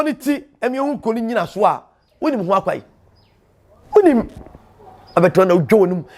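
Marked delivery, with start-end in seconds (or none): 3.9-4.3 emphatic cry. Real, not acted. Emphatic rhetorical exclamation.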